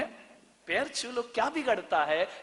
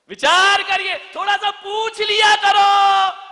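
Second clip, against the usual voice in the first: preaching shout; 12 semitones higher, 10 dB louder than usual. Real, not acted. Preacher urging daughters-in-law.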